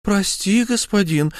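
high, sweetly false humility; bow to him with a smirk in the voice; wife feigning submission to her husband; mocking meekness. A narrator's reading. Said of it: "с насмешливой кротостью".

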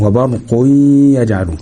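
وبرق يجعلون as "barquy-yaj'alun", y held; pausing on ghunna. Shown as a demonstration. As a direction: linger on the nasal y between وبرق and يجعلون. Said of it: "هقف عليها سنة كده".

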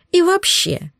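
high, huffy outburst; indignant countess. A narrator's reading. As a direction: bristle like a snob. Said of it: "возмутилась тупица".

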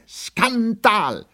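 very high-pitched cry of outrage; outraged shout. A narrator's reading. Lieutenant screams at his comrades for the first time, outraged shout. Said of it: "schrie er".

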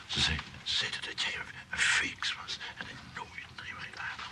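soft whisper, passing on nasty gossip. Acted. Johan whispering the staff's gossip to Clivia. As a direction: whisper it like a secret.

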